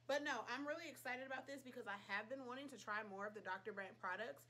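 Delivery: ordinary talk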